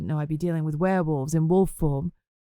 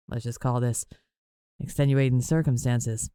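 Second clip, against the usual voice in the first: low male voice; 6 semitones lower than usual. A narrator's reading. Whip's low intimate reply.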